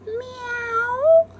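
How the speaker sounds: kitty meow; cat meow. Acted. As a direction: mew like a cat. Cat sound in story.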